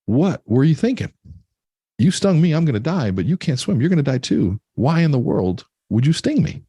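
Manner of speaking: high-pitched, bewildered frog; acted dismayed protest. Dying frog confronts scorpion.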